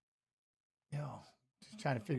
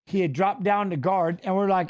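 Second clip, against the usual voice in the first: ordinary talk, nothing marked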